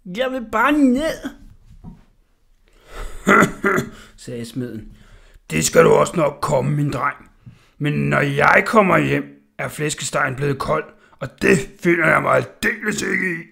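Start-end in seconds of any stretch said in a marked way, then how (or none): 0.0-1.3 high, slow whiny plea; boy begging to get down; pleading boy. Acted. Orla begs while hanging. 2.9-4.1 loud hearty villain laugh; gloating laugh. Acted. Smith laughs. 5.5-13.4 loud, gruff menacing voice; stern blacksmith. Acted. Smith threatens Orla.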